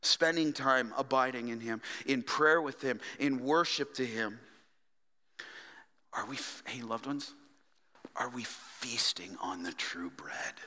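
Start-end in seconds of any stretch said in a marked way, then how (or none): 6.1-10.5 hushed whispered question; a preacher's sudden whisper. Real, not acted. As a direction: lean in and whisper it.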